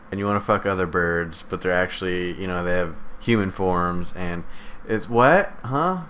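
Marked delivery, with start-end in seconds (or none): 5.1-6.0 higher, baffled outburst; puzzled double take; mock confusion. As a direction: sound genuinely thrown. Acted confusion at game.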